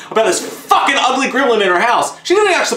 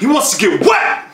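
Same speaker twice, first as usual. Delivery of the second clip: excited exclamation. Acted. Friend echoes the line loudly.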